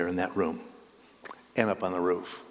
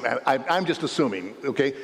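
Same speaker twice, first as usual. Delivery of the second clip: usual speaking voice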